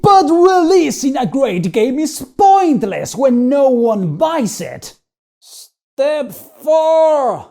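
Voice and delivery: loud, high dramatic declaration; booming announcer. Acted. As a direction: announce it like a trailer voiceover.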